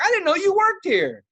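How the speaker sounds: high, hollered surprise; loud mock surprise. Customer to his stripping coworker, loud surprise. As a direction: announce it to the whole room.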